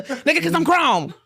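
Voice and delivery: mock stern parent cutting off a question; dismissive parent. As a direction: shut the kid down with a laugh. Parent shutting down kid's why.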